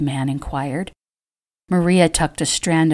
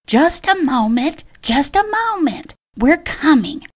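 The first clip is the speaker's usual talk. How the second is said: much higher, shrill-pitched screech of the aunt; 10 semitones higher than usual. A narrator's reading. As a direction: call out to the door in a high screech. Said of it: "her aunt screeched".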